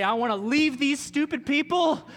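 loud, high whiny outburst against housemates; fed-up housemate. Retold or imitated. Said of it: "and you say".

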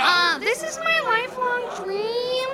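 put-on very high-pitched girly stand-up voice; first-time comic in a high, put-on voice; gushing amateur comedian.

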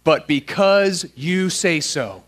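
slow, measured words for a group to join; half-speed "because you say so", each word set apart; led recitation. Shown as a demonstration. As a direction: lead the room word by word through "because you say so". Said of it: "say out loud with me".

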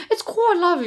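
a hint of a chimney-sweep Cockney, laughing; mock posh British. Retelling the mom's fake accent, light mock Cockney. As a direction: lilt it like a bad stage Brit. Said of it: "Nebula's mom's like".

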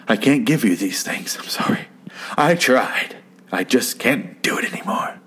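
hammed-up, mostly whispered apology; mock breakup whisper. Old man breaking up with Fred, in a mock whisper. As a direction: whisper it like a melodramatic breakup, voice lifting on the middle plea.

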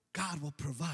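soft reassurance. Retold or imitated. Father gently reassures son.

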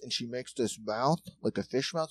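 usual speaking voice

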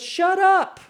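high, loud acted yell; sharp rise into a loud command; crowd shouting him down. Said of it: "telling him to shut up".

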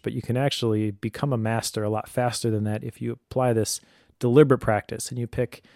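ordinary talk, nothing marked

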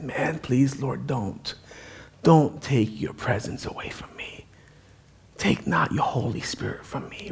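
soft, low, near-whispered prayer; hushed plea. Acted. Israelites begging the Lord to stay, hushed and pleading. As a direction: sink into a humble, breath-thin begging.

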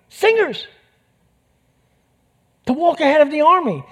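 preacher astonished at the king's choice; amazed exclamation. Real, not acted. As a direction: say it in disbelief. Preacher marvelling at plan.

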